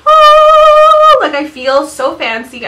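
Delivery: excited squeal. Retold or imitated. Reacting to getting PR.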